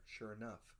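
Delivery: usual speaking voice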